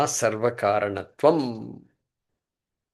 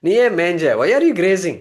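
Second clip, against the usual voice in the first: mock calling out; 5 semitones higher, 7 dB louder, much faster than usual. Asking the cow in story.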